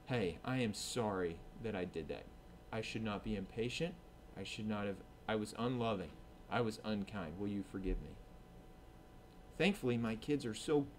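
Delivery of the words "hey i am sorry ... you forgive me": humble apology. Retold or imitated. Apologizing to kids upstairs.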